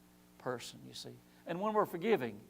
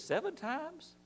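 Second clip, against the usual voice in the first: Peter asking; 8 semitones higher, 6 dB louder than usual. Retold or imitated. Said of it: "Peter says".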